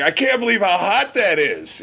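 high, laughing through the words; laughing in disbelief. Retold or imitated. Friend laughs as the pepper chip burns, laughing. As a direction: laugh it out, amazed at the heat. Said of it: "just was cracking up".

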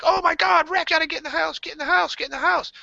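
high, urgent cry; frantic, repeated high-pitched urging; retold panic. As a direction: call the dog inside in a high-pitched panic. Acted panic in retold dream.

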